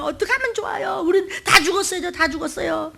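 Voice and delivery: high crying plea; weeping plea. Retold or imitated. Moses weeping before God at the sea, crying. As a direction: plead through tears. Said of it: "막 울며".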